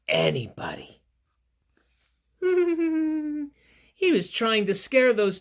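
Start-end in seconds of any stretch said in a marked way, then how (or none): 2.4-3.5 high mock laugh. Monster scene, high laugh.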